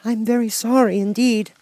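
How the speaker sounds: put-on high child's voice, apologetic; a scared little girl saying sorry; young girl, timid and sorry; frightened girl. A narrator's reading. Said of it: "said Dorothy".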